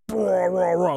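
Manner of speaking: loud mock monster roar; fish attack roar. Big puffer attacking the little one, mock roar. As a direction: roar like a tiny angry beast. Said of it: "big one was like".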